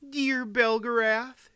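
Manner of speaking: high, slow, affectionate address; high-voiced queen's farewell; fond queen. A narrator's reading. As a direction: address an old friend in a high voice. Queen says farewell to old friend.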